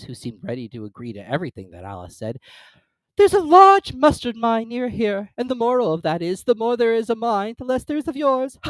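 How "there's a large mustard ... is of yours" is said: put-on high, loud fussy old duchess. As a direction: prattle like a silly duchess. Duchess character voice.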